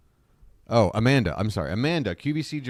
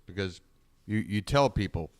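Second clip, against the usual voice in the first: ordinary delivery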